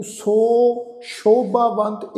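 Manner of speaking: "ਸੋ" stretched long before "ਸ਼ੋਭਾਵੰਤ"; overlong vowel. Shown as a demonstration. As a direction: draw out the o of "ਸੋ", then pause. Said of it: "ਇਸ ਢੰਗ ਨਾਲ ਉਚਾਰਨ ਨਹੀਂ ਕਰਨਾ".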